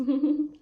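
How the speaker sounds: short bright laugh; amused laugh. Real, not acted. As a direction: laugh at your own joke. Laughs at her own joke.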